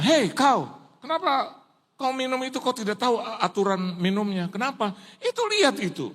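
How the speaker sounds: mock-angry telling-off; acted parental scolding. Parent scolds the drinker in joke.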